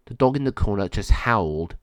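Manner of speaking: plain reading voice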